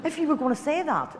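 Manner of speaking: high, laughing teasing scold; lightly mocking why; mock exasperation. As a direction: tease her with a laugh. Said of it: "I'm going".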